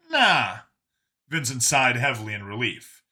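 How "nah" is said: Brian, dismissive. Acted. Brian's casual denial.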